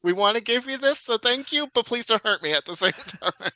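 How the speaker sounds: imitating wary presenters, ending in a laugh; tentative, placating thanks, played for laughs; nervous. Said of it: "they're more nervous to give it".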